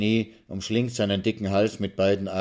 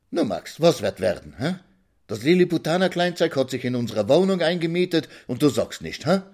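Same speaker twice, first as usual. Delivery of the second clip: higher-voiced, nagging old woman; 8 semitones higher, 3 dB louder than usual. A narrator's reading. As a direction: needle him with quick, demanding questions. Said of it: "sagt die Alte am Fenster". (keifende Alte, vorwurfsvoll fragend)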